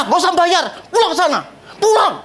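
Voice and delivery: half-hearted shout of a fed-up owner; mock angry owner. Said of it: "Marah loh". (usiran marah pemilik restoran yang ditirukan)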